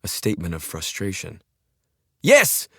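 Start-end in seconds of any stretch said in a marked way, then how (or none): 2.2-2.7 sharp high scream; frantic scream at the pilot. Acted. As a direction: bark the destination as a scream. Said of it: "Jelak screamed every word".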